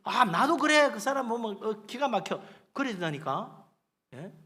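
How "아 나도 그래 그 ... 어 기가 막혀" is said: high, indignant mock backbiting; gossipy bad-mouthing. Preacher imitates friends bad-mouthing a boaster, indignant gossip. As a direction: imitate two gossips running someone down. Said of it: "그러고 가서 막 씹어대는 거야".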